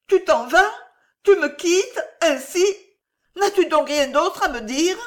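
high, loud, pleading questions; tearful, distraught. A narrator's reading. Tearful woman questioning the man leaving her, distraught. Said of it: "les yeux noyés de larmes, elle balbutia machinalement".

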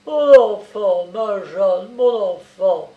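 loud, emotional outpouring of tenderness; slow, heartfelt exclamation; tender old man. A narrator's reading. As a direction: cry out to comfort a beloved child. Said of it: "répétait le vieillard en la serrant tendrement".

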